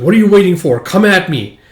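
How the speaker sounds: a hammed-up brash challenge; mock goading. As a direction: egg her on to fight. Swordsman goading.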